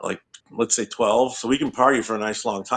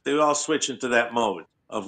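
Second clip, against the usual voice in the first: ordinary delivery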